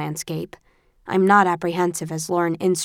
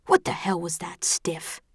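hushed reproach; 5 dB quieter than usual. A narrator's reading. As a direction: berate under your breath. Tobias berates her after failing.

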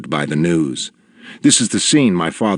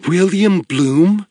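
higher, pleading question; a small tearful woman pleading; imploring woman; 11 semitones higher, slower than usual. A narrator's reading. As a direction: beg him to say yes. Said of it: "looked at me imploringly".

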